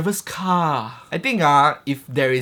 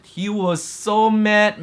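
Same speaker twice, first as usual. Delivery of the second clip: ordinary talk, nothing marked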